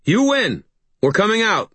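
high surrendering call. A narrator's reading. Harry giving in to Maeve outside, calling out. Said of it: "I called".

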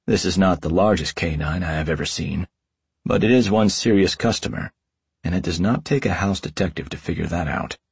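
ordinary delivery, nothing marked

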